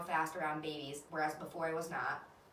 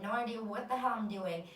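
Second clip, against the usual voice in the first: ordinary delivery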